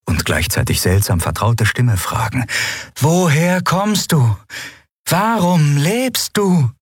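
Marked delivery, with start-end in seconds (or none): 3.0-6.7 high, very slow, otherworldly lilt; strange inhuman voice. Acted. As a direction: ask like a melodic alien creature. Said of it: "mit einem merkwürdigen Akzent, einem melodischen Singsang".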